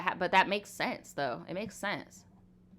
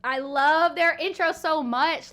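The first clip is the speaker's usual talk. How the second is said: gushing excitement; 5 semitones higher, 6 dB louder than usual. Real, not acted. Gushing about anime intro.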